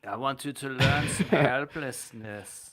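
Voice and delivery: slow, menacing voice of a cruel researcher; creepy torturer. Retold or imitated. Co-host mocks the researcher as a slow creepy torturer. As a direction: coax the victim slowly, like a sadist.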